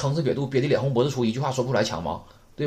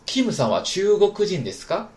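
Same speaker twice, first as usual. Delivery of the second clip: model reading; 7 semitones higher, 4 dB louder than usual. Shown as a demonstration. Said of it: "我们把这例子再读一下".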